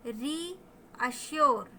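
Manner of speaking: "रीअश्योर" slowly split as री, अश्योर; long ee. Shown as a demonstration. Teacher introducing reassure to learners, split into parts with long ee. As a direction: hold "री" long, pause, then finish "रीअश्योर". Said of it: "वो बड़ी ई की मात्रा दे रहा है".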